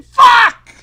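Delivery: explosive yell of frustration; furious shout. Real, not acted. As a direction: scream it out in rage. Sudden outburst while playing.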